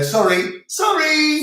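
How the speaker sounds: rising, fluttery sorries; apologetic English sorry. Shown as a demonstration. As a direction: say sorry like a flustered Brit. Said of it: "in England, you go".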